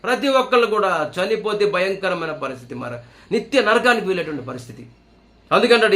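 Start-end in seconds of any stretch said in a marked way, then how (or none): none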